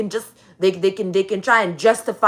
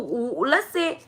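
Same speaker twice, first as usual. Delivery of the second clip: ordinary talk, nothing marked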